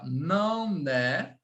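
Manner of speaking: "Não é" muito esticado, terminando em é aberto; modelo bem lento. Shown as a demonstration. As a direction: diga "Não é" devagar, sílaba por sílaba alongada, para o aluno copiar. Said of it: "Calma, vai aqui". ("Não é" lento e ligado)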